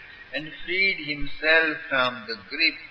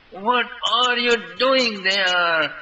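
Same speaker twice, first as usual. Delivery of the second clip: higher, louder panicked cry; panicked shout; 7 semitones higher, 4 dB louder than usual. A narrator's reading. Boys panicking as Krishna nears the edge, loud. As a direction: shout up in alarm. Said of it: "all Gopals shouted in a panic".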